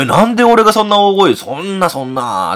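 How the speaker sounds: high, loud retold protest that tails off; indignant mock outburst, only a little angry; slightly snapped. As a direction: re-enact a mildly miffed objection. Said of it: "ちょっとだけちょっと切れてましたね".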